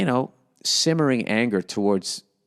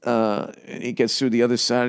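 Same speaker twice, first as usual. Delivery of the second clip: normal talking voice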